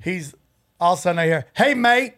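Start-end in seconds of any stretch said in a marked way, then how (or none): 1.5-2.1 a hint of a badly faked Australian accent on Hey, mate; lightly mimicking an Australian husband's greeting. The jealous husband calls Sam, in a weak Australian accent. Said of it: "Even as bad as you are at accents".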